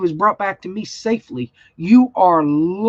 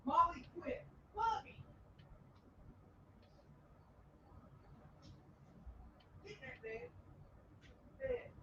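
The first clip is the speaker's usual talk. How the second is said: distant, much softer, higher-pitched commands; off-mic aside; 9 semitones higher, 31 dB quieter than usual. Real, not acted. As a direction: call to the dog from across the room. Aside to dog, away from microphone.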